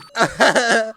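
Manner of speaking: high, loud gloating laugh; short villain cackle; mocking cackle. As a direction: laugh loudly at their protest. Taunter laughs at protest.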